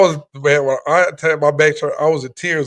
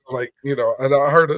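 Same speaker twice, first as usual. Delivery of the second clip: ordinary talk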